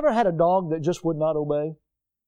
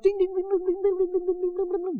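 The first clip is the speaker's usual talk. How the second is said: very high, rapid tinkling dings; fast sing-song ringing on one high note; imitated little bell; 12 semitones higher, 4 dB quieter than usual. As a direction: jingle like a tiny dog bell. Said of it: "It's got a little bell".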